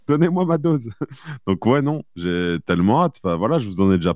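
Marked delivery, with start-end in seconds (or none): 0.1-0.9 mock junkie plea. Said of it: "j'ai fait".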